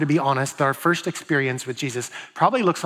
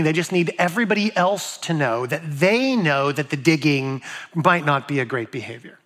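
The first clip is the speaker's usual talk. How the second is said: ordinary talk, nothing marked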